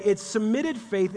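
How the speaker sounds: ordinary delivery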